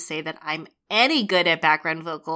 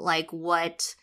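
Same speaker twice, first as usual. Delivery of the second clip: normal talking voice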